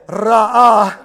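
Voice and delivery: "ra'ah" loudly trilled at the front; rolled R. Shown as a demonstration. Preacher shows off his practiced "ra'ah", R strongly rolled. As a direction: trill the R of "ra'ah", then open into long ah. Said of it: "which I worked all week to say".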